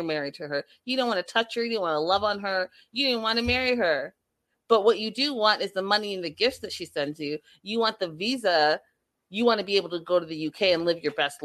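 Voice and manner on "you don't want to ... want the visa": normal talking voice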